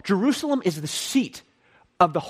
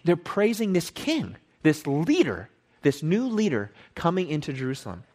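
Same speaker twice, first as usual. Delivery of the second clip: usual speaking voice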